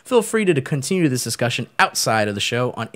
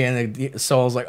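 usual speaking voice

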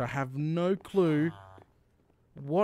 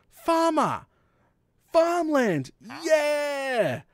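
excited; 11 semitones higher, 5 dB louder, much slower than usual. Real, not acted. Spots farmers.

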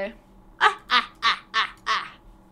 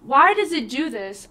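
normal talking voice

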